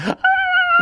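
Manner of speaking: high yelping howl; dog howl imitation. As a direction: imitate the dog howling at the noise. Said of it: "and he'd be like".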